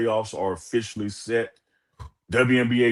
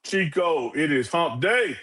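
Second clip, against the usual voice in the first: hyped show opener; 10 semitones higher than usual. Real, not acted. Host opening the show to listeners, hyped high call. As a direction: fire up the listeners like a radio host.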